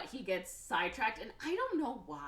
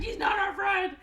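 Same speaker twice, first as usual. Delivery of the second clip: shouted denial; 11 dB louder than usual. Retold or imitated. Eighteen answers angrily.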